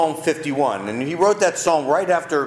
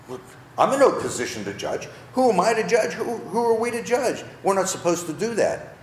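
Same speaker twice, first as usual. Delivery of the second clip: mock humble protest; 5 semitones higher than usual. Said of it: "it makes it sound like".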